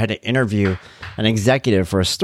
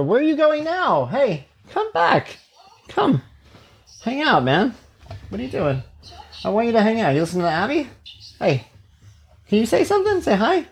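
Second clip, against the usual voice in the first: much higher, sing-song coaxing; 12 semitones higher than usual. Real, not acted. Dad coaxing his son to come hang out, high and sing-song.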